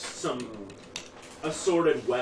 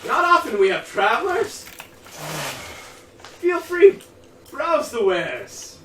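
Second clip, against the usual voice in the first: high, loud, frantic little shopkeeper; excited halfling shopkeeper; 10 dB louder, slower than usual. Acted. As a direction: squeal it out like a flustered halfling. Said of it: "this disheveled halfling comes out and goes".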